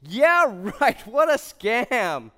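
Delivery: hammed-up scornful dismissal; scoffing. Reacting to a free goggles offer, scoffing. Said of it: "I'm like".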